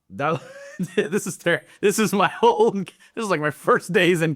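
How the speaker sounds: real laugh breaking up the words. Real, not acted. Soldier recalling miserable first days in country, laughing.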